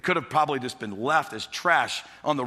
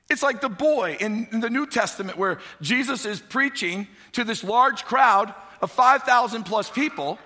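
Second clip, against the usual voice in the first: higher, louder storytelling sermon voice; animated preaching; 7 semitones higher, 5 dB louder than usual. Acted. Pastor retelling the loaves and fishes to church, animated. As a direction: act out the story for the church, lively.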